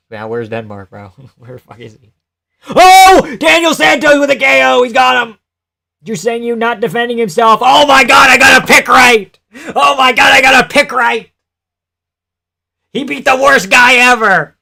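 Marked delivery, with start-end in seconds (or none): 2.7-14.6 screaming with joy, high and loud; excited shout. Real, not acted. Streamer yelling to chat as his pick wins. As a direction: erupt in celebration over the knockout.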